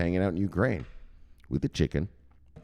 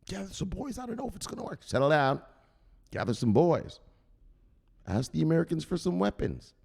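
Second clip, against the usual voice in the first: Robbie advising; 10 semitones higher than usual. Retold or imitated.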